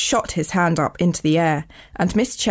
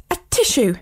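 high-pitched mock sneeze; a sudden storybook sneeze; acted sneeze; 6 semitones higher than usual. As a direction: sneeze it out as a spoken "atishoo". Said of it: "everyone in the classroom was sneezing".

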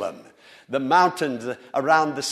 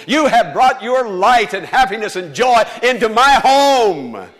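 loud, rising pulpit preaching; fervent preaching; 6 semitones higher, 8 dB louder than usual. Acted. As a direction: preach it out with full force to the congregation. Preacher exhorting congregation.

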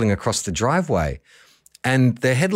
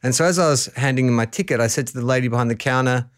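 usual speaking voice

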